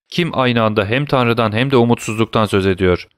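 usual speaking voice

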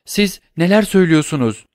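wife cries out against accusation; frightened cry. Acted. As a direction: cry out in horror, hands clasped. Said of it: "diye haykırdı".

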